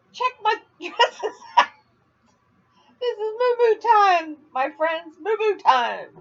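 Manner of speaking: high and loud, slow on moo-moo time; sing-song it like an ad; giddy. Real, not acted. Giddy after laughing fit.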